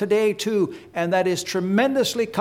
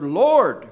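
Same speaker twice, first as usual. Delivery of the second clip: "Lord" louder, as one weighty word; emphatic Lord; 5 dB louder than usual. Real, not acted. Preacher singles out the word "Lord" for listeners, loud and emphatic. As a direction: land firmly on "Lord" by itself. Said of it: "I want to emphasize that word".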